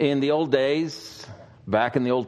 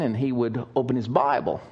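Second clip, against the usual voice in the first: usual speaking voice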